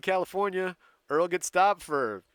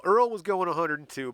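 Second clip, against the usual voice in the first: ordinary delivery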